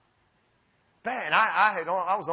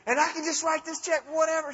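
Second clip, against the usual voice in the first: high, incredulous question; acted-out amazed squeak; freaking out; 10 semitones higher than usual. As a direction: ask it in comic disbelief, pitched up. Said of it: "I'm just freaking out".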